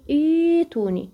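إيتوني slow with stretched ii; tajwid recitation. Shown as a demonstration. Teacher prompts students and answers إيتوني. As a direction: draw out the ii of إيتوني. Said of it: "بتقولي هنا إيه".

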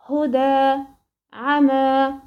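هدى and عمى slowly, each ending in a sustained open "aa"; pausal long vowel. Shown as a demonstration. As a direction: stop on هدى and عمى with the final "aa" held long. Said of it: "أنا وقفت هدى أهو".

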